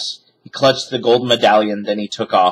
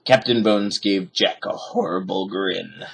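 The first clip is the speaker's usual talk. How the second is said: ordinary delivery, nothing marked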